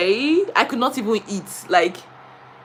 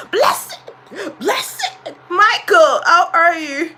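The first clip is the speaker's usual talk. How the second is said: director voice; 6 semitones higher, slower than usual. Retold or imitated. Director voiced in a retold story.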